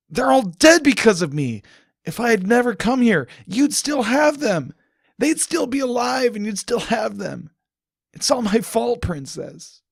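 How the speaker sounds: loud, high, guilt-stricken yelling; self-blaming outburst; anguished shout. Acted. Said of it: "more tears fell".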